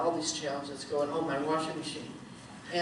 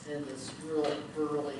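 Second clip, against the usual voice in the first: normal talking voice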